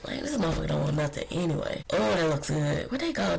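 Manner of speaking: normal talking voice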